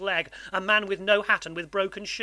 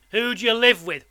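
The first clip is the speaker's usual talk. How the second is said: loud, raised, demanding question; menacing convict; 5 semitones higher, 8 dB louder than usual. A narrator's reading. Convict asks who boy lives with, menacing. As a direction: interrogate the frightened child.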